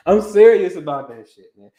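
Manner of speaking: real laugh, then laughing through the words. Real, not acted. Astrologer laughing at himself while giving the advice. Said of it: "I'm laughing 'cause I can see".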